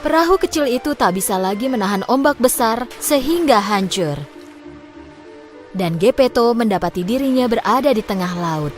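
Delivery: usual speaking voice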